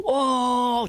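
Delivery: long, high mock yell; drawn-out "oh" of a falling devil; retold cry, held high; imitated scream. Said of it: "Teriak gitu".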